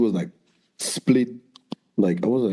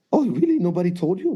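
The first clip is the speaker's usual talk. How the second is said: surprised question; 7 semitones higher than usual. Retold or imitated. Girl surprised they don't know.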